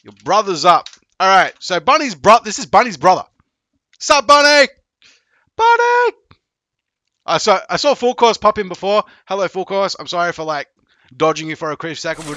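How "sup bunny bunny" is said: loud, high call of the name; sing-song shouted greeting. Real, not acted. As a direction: call out to Bunny across the room. Greeting viewer by calling out.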